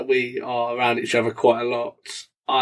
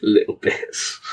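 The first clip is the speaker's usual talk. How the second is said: whispered little bits; quoted character voice; 4 semitones lower, slower than usual. Retold or imitated. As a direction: murmur it like a quote. Quoting a reference in a voice.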